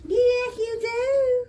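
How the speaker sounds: very high, drawn-out sing-song coo. Real, not acted. Clinician insisting to a fussy baby, very high baby talk.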